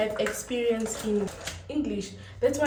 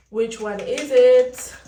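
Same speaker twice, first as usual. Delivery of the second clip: slow and louder, pressing the question; 3 semitones higher, 11 dB louder, much slower than usual. Real, not acted. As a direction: demand an answer: "Which one is it?" loud and slow.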